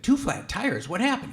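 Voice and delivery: incredulous. Retold or imitated. Dad reacting to son's call.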